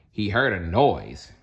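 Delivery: ordinary delivery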